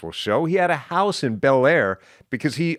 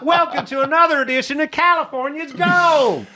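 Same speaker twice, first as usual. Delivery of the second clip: laughing mock Southern host greeting; Huell Hauser impression; 5 dB louder than usual. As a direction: gush like an overexcited Mississippi TV host. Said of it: "And he's like".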